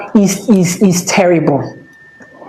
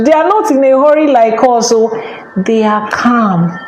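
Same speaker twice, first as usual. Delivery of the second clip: ordinary delivery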